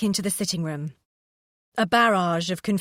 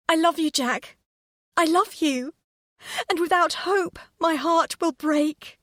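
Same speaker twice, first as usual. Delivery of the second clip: high, tearful declaration; sobbing; 9 semitones higher than usual. A narrator's reading. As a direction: cry it out, heart breaking. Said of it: "She was sobbing harder".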